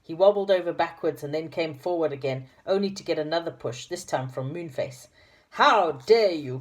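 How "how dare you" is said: slow, loud outraged cry; in a rage. Acted. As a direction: drag out each word in fury. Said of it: "cried the wobbly man in a rage".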